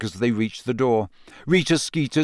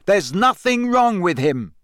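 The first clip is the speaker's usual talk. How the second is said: shouting; 8 semitones higher, 4 dB louder than usual. A narrator's reading. Harry still shouting.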